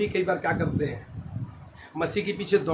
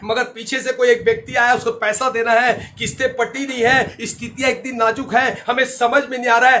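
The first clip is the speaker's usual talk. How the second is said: raised, driving sermon voice; full-force preaching; 8 semitones higher, 8 dB louder than usual. Real, not acted. Preacher urging worried believers to trust God, forceful preaching. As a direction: preach it out with urgency.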